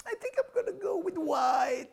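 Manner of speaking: high, whiny mock crying voice; high whining put-on voice; mock whiny diner. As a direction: whimper it like a fussy date.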